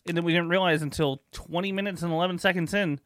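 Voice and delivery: normal talking voice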